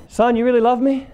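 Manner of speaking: a father testing his son's claim; father questioning his son, lightly voiced; skeptical dad.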